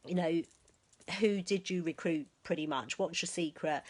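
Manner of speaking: ordinary talk, nothing marked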